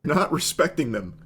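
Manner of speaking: ordinary delivery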